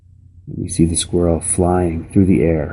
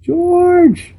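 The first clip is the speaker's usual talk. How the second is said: much higher, shrieked name; panicked mother shrieking George; frantic screams; 3 dB louder than usual. Acted. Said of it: "and then came the words".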